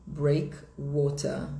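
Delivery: slow British demo, R dropped at the end of "breakwater"; sotaque britânico. Shown as a demonstration. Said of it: "Em inglês britânico". (sotaque britânico, devagar, sem o R final)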